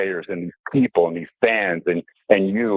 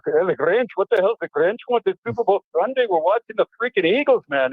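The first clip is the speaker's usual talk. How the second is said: much higher, hammed-up Scottish dad voice; 12 semitones higher than usual. Scottish dad scoffing at the Raiders-fan guest, hammed-up high Scottish accent. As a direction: imitate an indignant Scottish father, pitched way up. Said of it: "he had that hard Scottish accent".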